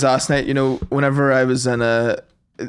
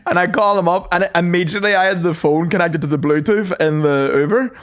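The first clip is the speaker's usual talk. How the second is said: ordinary talk, nothing marked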